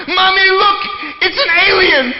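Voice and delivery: put-on high, loud child's cry of alarm; freaked-out little girl. Little girl telling her mommy about an alien, alarmed. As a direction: mimic a little girl calling out in panic.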